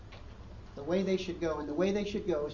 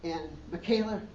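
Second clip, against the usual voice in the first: ordinary talk, nothing marked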